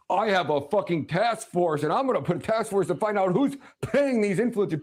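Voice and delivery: high-pitched mocking quote; mocking imitation. Imitating a politician announcing a task force, mocking. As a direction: lightly mimic in a derisive high voice.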